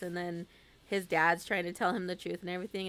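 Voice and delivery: ordinary delivery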